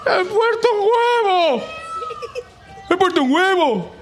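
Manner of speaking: very high delighted cow shout; excited shout. Acted. Macarena shouting to the farm that she laid an egg. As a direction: shriek the news with joy. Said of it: "gritó Macarena".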